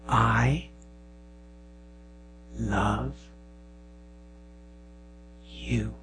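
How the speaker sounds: slow, soft I love you, paused word by word; tender hush. Retold or imitated. Father at bedtime whispering love to daughter, slow and soft. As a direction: breathe each word gently into her ear. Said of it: "whisper in her ear".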